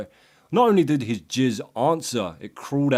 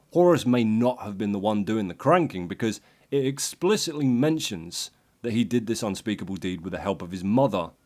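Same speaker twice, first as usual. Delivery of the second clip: normal talking voice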